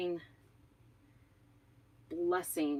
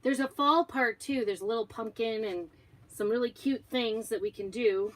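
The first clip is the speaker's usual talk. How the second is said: upbeat product pitch; 5 semitones higher, 5 dB louder than usual. Acted.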